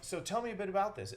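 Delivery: high-pitched, casual prompting; imitating a curious, friendly client in a lifted voice; put-on high, easygoing tone; Jeff Bezos. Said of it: "And he goes".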